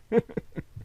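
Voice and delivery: eerie breathy laugh. A narrator's reading.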